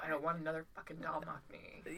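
fed-up hushed whisper. Retold or imitated. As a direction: whisper it like a confession. Voicing fed-up expat inner thought.

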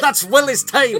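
high, loud Scottish groundskeeper; Scottish accent. Acted. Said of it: "that's a good Scottish accent".

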